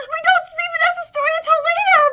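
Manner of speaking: high, fast protesting whine; agitated protest. Real, not acted. Fan objecting to an early spoiler, agitated protest.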